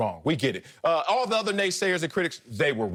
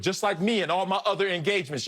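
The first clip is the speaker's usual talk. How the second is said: ordinary talk, nothing marked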